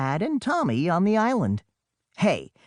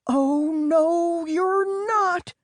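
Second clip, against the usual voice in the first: much higher, bold rescuer's voice; heroic cry; much slower than usual. Acted. Big sister cuts in heroically to save the twins, bold cry. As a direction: burst in like the hero arriving. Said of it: "cried the heroic voice".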